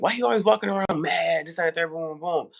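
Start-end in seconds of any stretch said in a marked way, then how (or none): 0.0-1.5 mocking onlookers. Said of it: "look at [name] like".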